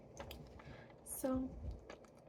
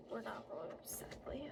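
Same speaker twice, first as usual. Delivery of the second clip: fairy whisper; 3 semitones lower, 9 dB quieter than usual. Acted.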